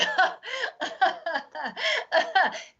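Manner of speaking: a forced, hollow audience laugh; fake laugh. Shown as a demonstration. Comic mocking an audience's polite laugh, forced and hollow. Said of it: "They're gonna give a fake laugh".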